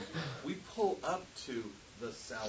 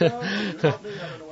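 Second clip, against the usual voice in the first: high, loud, laughing plea for help; mock needy student; 9 semitones higher, 17 dB louder than usual. Student begging a campus helper for help, laughing and high. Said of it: "real high maintenance".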